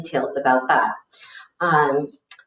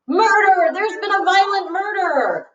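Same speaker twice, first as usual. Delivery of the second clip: alarmed unicorn cry; 12 semitones higher than usual. Acted. Talking unicorn announcing a violent murder, high alarmed cry. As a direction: cry murder high, long falling last word.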